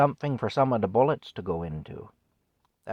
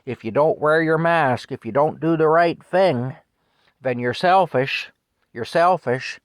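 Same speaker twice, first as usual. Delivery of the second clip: loud, raised-pitch imitation of a scold; mock scolding; 5 semitones higher, 7 dB louder than usual. Man mimicking the guilt messages to the public, loud scolding. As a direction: hammer it out like a preachy public warning.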